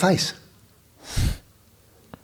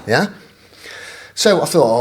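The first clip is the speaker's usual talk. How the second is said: ordinary talk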